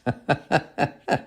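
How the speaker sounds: chuckling laugh. Real, not acted. Host chuckling at a misworded phrase in a listener's letter, laughing. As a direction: run of quick chuckles.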